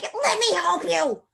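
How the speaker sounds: high-pitched spunky tomboy puppet; Ginger. Acted. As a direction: insist in a slow, high, feisty character voice. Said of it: "Ginger's, like, crazy spunk, like, tomboy".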